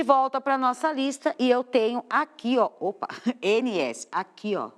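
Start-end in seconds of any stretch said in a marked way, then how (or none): none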